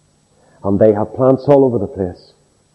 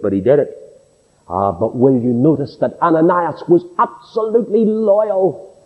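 ordinary talk, nothing marked

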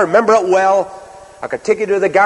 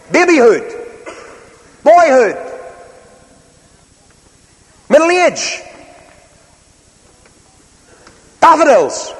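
rousing preaching; 8 semitones higher, slower than usual. Acted. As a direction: build a list toward Everything. Preaching the theme of passing.

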